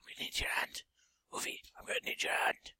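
hushed whisper to the patient. Pretend. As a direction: whisper the request softly.

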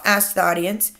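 ordinary delivery, nothing marked

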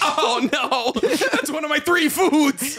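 high, drawn-out comic wail; hammed-up lament, pitched high; mock dismay. Acting out co-host's dismay.

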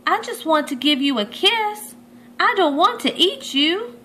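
lightly voiced shark begging; young shark pleading. A narrator's reading. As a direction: plead so he won't hide. Chomp pleading with stingray.

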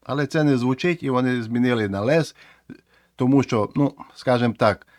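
ordinary talk

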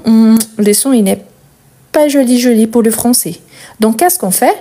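ordinary talk, nothing marked

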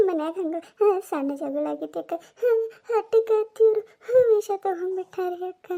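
acted sobbing explanation; crying. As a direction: sob the words out between breaths. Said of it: "ಎದಕ್ ಅಳಕತ್ತಿ". (ಅಳುತ್ತಾ ಬಿಕ್ಕುತ್ತಾ ಹೇಳುವುದು)

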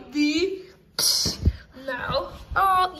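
ordinary talk

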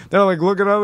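ordinary talk

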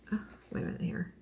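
under-breath whisper. Real, not acted. Crafter whispering to herself while searching the pattern. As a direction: whisper it to yourself.

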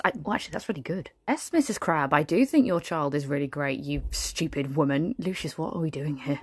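ordinary talk, nothing marked

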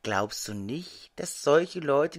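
usual speaking voice

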